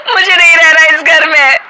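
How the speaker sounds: loud, high sobbing complaint; wife crying to her husband after a fight, sobbing. Acted. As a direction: wail to your husband in tears.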